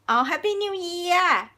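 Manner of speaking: a slow, cheerful Happy New Year in a Thai accent. Shown as a demonstration. Teacher imitates how Thais wish Happy New Year in a Thai accent. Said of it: "in Thai accent".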